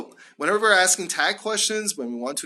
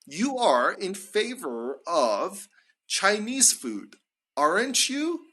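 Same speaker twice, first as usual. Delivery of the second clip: slow, weight on "are", "aren't you?" rising high; slow tag question intonation; 3 semitones higher, slower than usual. Shown as a demonstration. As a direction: spell out the tune slowly, then rise on "aren't you?". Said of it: "Our intonation is really important".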